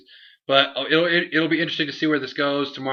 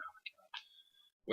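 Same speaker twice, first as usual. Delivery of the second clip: slow, breathless whispered disbelief; a slow mock whisper of disbelief; 18 dB quieter, much slower than usual. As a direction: hush it out in shock. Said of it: "I was like".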